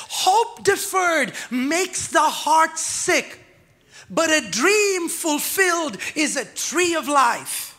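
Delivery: slow, rising scripture reading with a shout; slow preached scripture. Real, not acted. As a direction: proclaim it, stretching each word. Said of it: "the Bible says".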